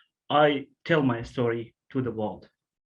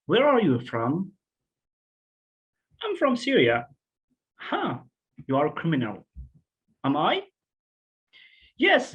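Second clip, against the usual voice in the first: acted dialogue; 3 semitones higher than usual. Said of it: "in a dialogue between the world and a Syrian person".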